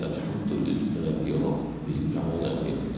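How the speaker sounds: soft, low, slow "Alhamdulillahi Rabbil Alamin" with doubles unpressed; low, soft faulty recitation of Alhamdulillahi Rabbil Alamin; wrong reading. Shown as a demonstration. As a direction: let ll and bb slacken in Alhamdulillahi Rabbil. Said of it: "Jangan membaca demikian".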